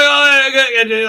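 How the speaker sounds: high, loud smug purr. Retold or imitated. Bull Queen gloating while they slingshot, loud smug gloat. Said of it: "smugness going on".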